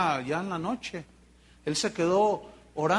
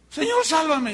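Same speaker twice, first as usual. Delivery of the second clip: desperate plea; 8 semitones higher, 4 dB louder than usual. Retold or imitated. Peter sinking, crying for help.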